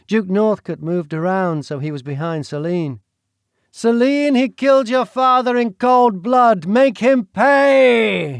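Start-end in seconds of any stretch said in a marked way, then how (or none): 3.7-8.4 a hammed-up growl, higher and louder. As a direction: hiss revenge right into her ear. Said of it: "he growled into her ear".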